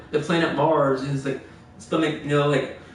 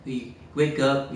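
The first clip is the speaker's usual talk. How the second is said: ordinary talk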